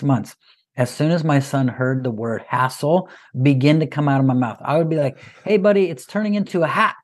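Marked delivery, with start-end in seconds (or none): 5.4-7.0 singsong teasing warning, higher; playful warning. Retold or imitated. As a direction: tease the kid with a sing-song heads-up. Dad's playful warning to son.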